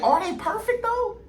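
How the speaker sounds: high, mocking sing-song doubt; mocking question. Asking friends if they're perfect, high mocking. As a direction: sneer at the idea they're perfect.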